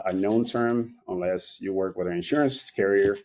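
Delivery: ordinary talk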